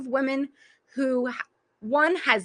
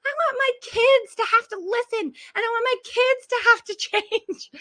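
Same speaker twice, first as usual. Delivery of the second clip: put-on much higher, whiny crying yell like a child; mock tantrum; 9 semitones higher than usual. Coach reliving her own tantrum over having to change, whiny crying yell. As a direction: wail it out like a kid refusing to do chores. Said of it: "once you can have your tantrum".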